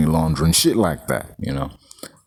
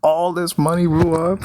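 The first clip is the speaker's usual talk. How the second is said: alarmed; 10 semitones higher than usual. Retold or imitated. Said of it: "he knows".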